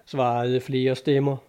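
ordinary delivery, nothing marked

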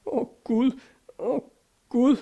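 high wailing cry of grief; sobbing and wailing. A narrator's reading. Karin wailing over the dead friend. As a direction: wail it, close to sobbing. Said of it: "hun begyndte at hulke og jamre sig".

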